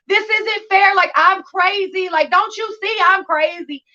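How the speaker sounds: high, whiny protest, a bit slow; mock whiny pleading. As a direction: play it like a sulky kid begging. Retold pleading to counselor.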